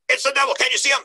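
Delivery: high, urgent mock panic; imitating a panicked man; freaking out. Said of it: "he's getting all freaking out".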